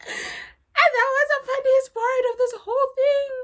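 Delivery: high, loud, laughing hard. Real, not acted. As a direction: talk through helpless laughter. Cracking up over funny scene.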